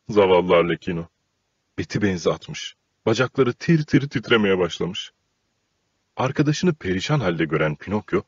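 ordinary delivery, nothing marked

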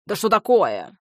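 frowning demand. Acted. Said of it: "нахмурился Казимир".

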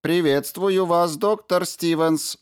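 high, slow, even machine voice; slow robot voice. A narrator's reading. Ram the robot greets the doctor, slow and mechanical. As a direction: greet him like a polite robot.

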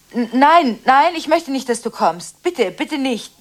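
loud, pleading no; urgent refusal. Acted. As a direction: beg him loudly not to come. Refusing friend's visit.

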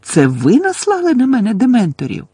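high, loud incredulous question; loud, outraged astonishment; shocked disbelief. A narrator's reading. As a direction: demand an answer, aghast. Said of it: "трохи не задихнувся Гаррі".

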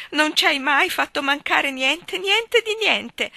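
high, very slow tearful insistence. A narrator's reading. Mrs Taylor tearfully insisting to her husband, high and slow. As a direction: draw it out through tears. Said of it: "tra le lacrime".

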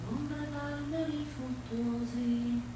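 slow, soft, dreamy recitation; hushed poem read far away; poem recital. Acted. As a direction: lay each image down slowly.

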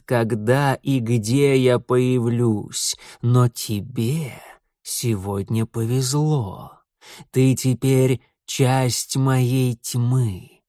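very slow, ominous lord of darkness; dark lord. Acted. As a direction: intone it slowly, like a dark god. Setil's dark speech.

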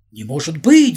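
loud stunned protest; disbelieving shout. Acted. Vadim Afanasyevich protesting on the platform, loud disbelieving shout. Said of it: "закричал и затопал ногами".

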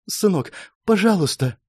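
high, faltering mother's voice; tearful plea. Acted. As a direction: beg your son, holding back a sob. Mother pleads, holding back sobs. (умоляюще, сдерживая всхлип)

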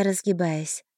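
ordinary delivery, nothing marked

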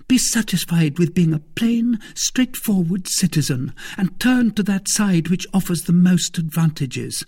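high, brisk woman's voice; Madame Bonacieux, practical wife. Acted. Wife telling husband to be a plain citizen, dismissive. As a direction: advise him with impatient scorn.

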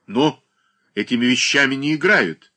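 imposing, weighty. A narrator's reading. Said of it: "внушительно произнёс Данила Захарыч".

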